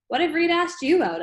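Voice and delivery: high, loud teasing question. A narrator's reading.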